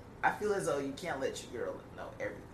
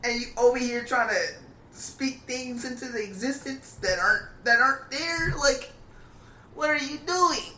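high, loud disbelief; telling his friend off, high exasperated rant; 7 semitones higher, 4 dB louder than usual. Retold or imitated. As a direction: climb higher with each outraged phrase. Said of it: "I was like".

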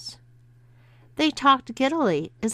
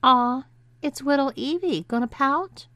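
mock baby-talk taunt, higher; 6 semitones higher than usual. A narrator's reading. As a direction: tease like cooing at a pouting baby. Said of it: "taunted Linda".

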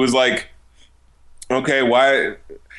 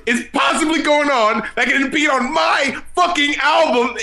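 higher, indignant mock outrage; a hammed-up rapper's outrage; 8 semitones higher than usual. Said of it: "imagine him getting indignant".

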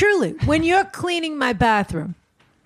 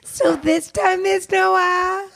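mocking imitation; 8 semitones higher, much slower than usual. Mocking the other host's question.

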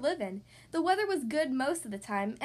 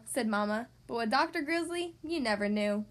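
usual speaking voice

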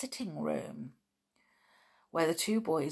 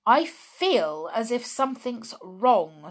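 mildly stubborn, higher and louder; obstinate; 5 semitones higher, 8 dB louder than usual. A narrator's reading. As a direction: insist on it stubbornly. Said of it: "looking obstinate".

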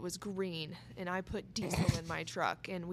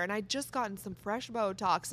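normal talking voice

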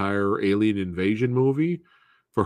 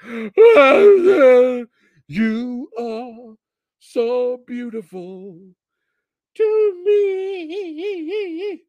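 high, crying, drawn-out melodramatic swoon; mock weepy lover; 8 dB louder, much slower than usual. Mocking the film's clone scene with a tearful swoon. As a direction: ham up a tearful lover's confession, high and slow. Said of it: "the other samurai guy and be like".

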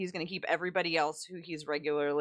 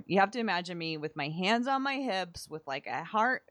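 normal talking voice

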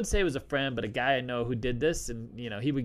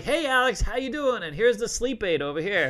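higher and louder mock fan voice, cheery and eager; excited fan greeting; 7 semitones higher, 3 dB louder than usual. Fans greeting the host who puts them to sleep, high eager mock voice. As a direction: play an eager fan spotting Alex in public. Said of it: "They're like".

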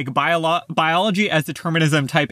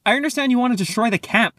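mild narrated yell; 7 semitones higher than usual. A narrator's reading. Annabeth accuses Luke of destroying the camp, mildly yelling. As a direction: read a yell at talking volume, higher. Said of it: "she yelled".

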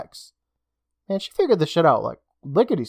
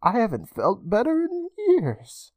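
higher, slow, sinister lilt; eerie vampire voice; 9 semitones higher, slower than usual. Acted. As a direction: savour it with creepy glee. Vampire librarian reveals herself.

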